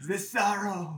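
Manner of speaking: high, laughing mock French lament; a tragic French poet, laughing; French accent. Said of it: "so it's in a French accent".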